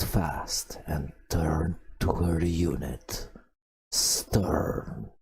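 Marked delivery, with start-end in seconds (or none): none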